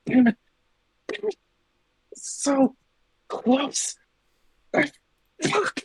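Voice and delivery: frustrated high cursing to himself; angry muttering. Acted. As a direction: spit out the curses in frustration. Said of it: "these constant mutterings to himself".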